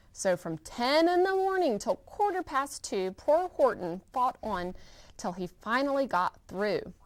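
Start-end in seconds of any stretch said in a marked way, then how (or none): none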